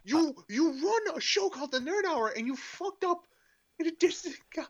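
high, loud hammed-up fury; mock furious rant. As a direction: rant in exaggerated outrage.

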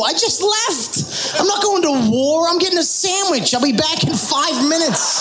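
ordinary delivery

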